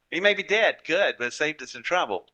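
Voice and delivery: a lightly voiced corporate client, brushing off a death; mock callous boss. Clients in corporate America imagined callously.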